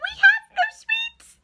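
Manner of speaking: very high, slow tiny voices; tiny ants. Acted. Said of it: "the ants replied".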